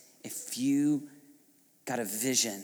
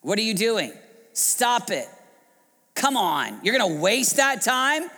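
higher-pitched scolding disbelief; incredulous, raised challenge; mocking rebuke; 8 semitones higher, 4 dB louder than usual. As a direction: rebuke someone as if they're crazy. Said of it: "people that will rebuke you".

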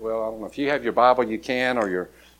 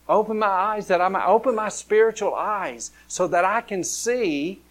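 higher, rising pleading prayer; fervent prayer; 5 semitones higher than usual. Acted. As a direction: pray aloud for the whole church. Leads the congregation in prayer.

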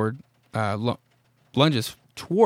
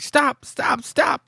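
panicked yelling; 13 semitones higher, much slower than usual. Retold or imitated. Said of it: "He's like".

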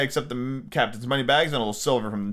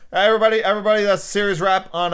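loud toast; 5 semitones higher, 4 dB louder, faster than usual. Real, not acted. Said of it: "I'm not drunk".

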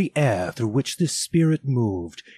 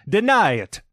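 much higher, loud command; cried out; 10 semitones higher, 5 dB louder than usual. Acted. Spirit cries out to Scrooge, loud and high. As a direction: fling the order at him. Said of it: "cried the spirit".

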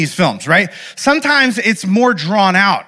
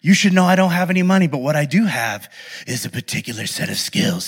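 low, gruff threat down the phone; deep gravelly menace, lower and quieter; gruff movie hero; 3 semitones lower, 4 dB quieter than usual. Retold or imitated. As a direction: growl it like an action-movie dad. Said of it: "And he says it all gruff".

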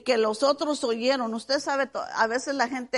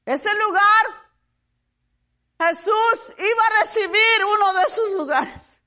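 loud, high, driving sermon voice; full-force preaching; 8 semitones higher, 9 dB louder than usual. Real, not acted. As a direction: proclaim it fervently to the whole congregation.

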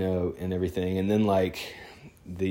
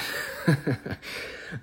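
soft, high, airy chuckle; quiet laugh after a joke; amused laugh; 7 semitones higher, 5 dB quieter than usual. Real, not acted. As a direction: laugh lightly at your own remark. Laughs after joking about getting paid.

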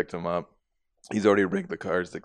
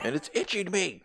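put-on whiny kid; 10 semitones higher, 4 dB quieter than usual. Boy complaining.

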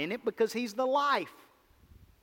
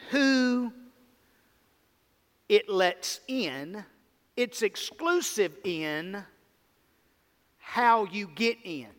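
slow deliberate emphasis; much slower than usual. Real, not acted. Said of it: "slow deliberate contrast".

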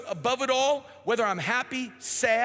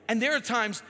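normal talking voice

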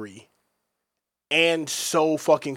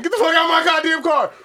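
high, loud yell at yourself; panicked shout; 13 semitones higher, 6 dB louder than usual. Retold or imitated. Young man yelling at himself to drive away. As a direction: bark it in a rush to escape. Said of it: "I'm like".

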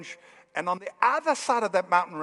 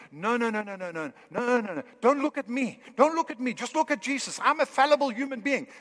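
mock protest; 5 semitones higher than usual. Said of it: "We often think".

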